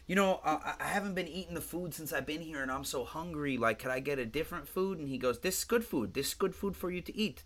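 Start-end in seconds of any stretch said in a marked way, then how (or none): 0.1-5.0 higher, lightly voiced complaining patient; a hint of a pleading lady; imitated pleading patient. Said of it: "the lady goes". 5.4-7.4 higher-pitched mock Indian accent; Indian-accented doctor impression, pitched up; imitated Indian accent. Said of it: "He's, like, an Indian guy".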